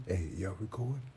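ordinary delivery

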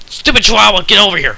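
mock angry yell. Yelling at a cell.

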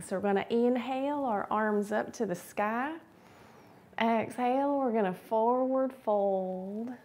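drawn-out Texas twang for instructions. Pretend. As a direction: lead the stretch in a playful Texas twang. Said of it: "in our Texas twang".

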